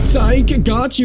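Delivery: teasing psych. Pretend. Teasing fake-out to viewers.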